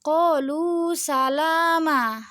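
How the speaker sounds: drawn-out recitation of QALU SALAMA; contoh bacaan mad iwad. Shown as a demonstration. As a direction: hold the long vowels in "qalu salama", ending open. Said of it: "di akhirnya itu kan". (QALU SALAMA dilantunkan dengan vokal panjang)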